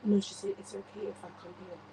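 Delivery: ordinary talk, nothing marked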